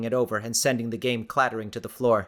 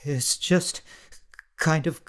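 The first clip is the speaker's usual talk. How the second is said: forceful insisting between gasps; slow, trembling denial through cold; shivering; 2 semitones higher, slower than usual. A narrator's reading. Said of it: "forceful insistences between gasps".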